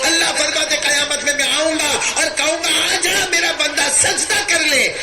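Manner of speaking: high, ringing divine summons; impassioned preaching. Acted. God calling his servant to prostrate, high impassioned preaching. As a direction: call out high, stretching the final words.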